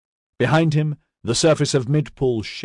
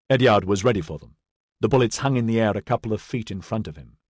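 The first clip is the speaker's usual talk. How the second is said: usual speaking voice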